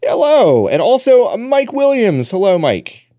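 high, loud cheerful hello; excited greeting. Real, not acted. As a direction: greet them big and bright. Host greets guests cheerfully.

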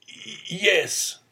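stammered yes. A narrator's reading. Old father caught in a lie, stammering. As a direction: catch on the first sound, then get the yes out. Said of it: "stammered the old man".

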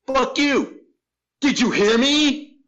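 high, loud furious shout back; angry stranger yelling. Retold or imitated. Stranger snaps at the masker, furious shout. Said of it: "And he went".

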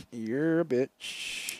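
dragged out very slowly; very slow drawl. Acted. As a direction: spell it out slowly as if writing. Mock dictation.

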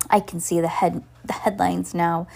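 usual speaking voice

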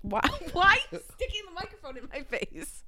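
laughing. Real, not acted. Microphone pushed at her face.

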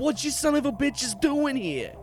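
high, irritated snarl; grumpy Jake. Acted. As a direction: snap at the unwanted guests. Jake snaps at visitors.

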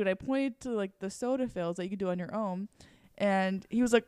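ordinary talk, nothing marked

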